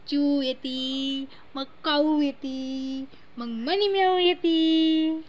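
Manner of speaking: slow, lilting lines with stretched endings; sing-song baby talk. Pretend. Mother listing animal visitors to her baby, sing-song. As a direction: build it up like a nursery rhyme.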